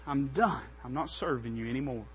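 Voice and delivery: low, soft, acted giving up; resigned quitting. Preacher as Jeremiah refusing to serve God, low resignation. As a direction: sink from outcry into tired refusal.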